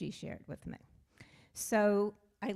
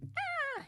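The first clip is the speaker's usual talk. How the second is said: a sharp, much higher startled yelp; imitated scream; 4 dB quieter than usual. Retelling Richard's shocked cry in the car, high yelp. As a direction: mimic a friend's sudden cry of shock. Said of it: "Richard screams".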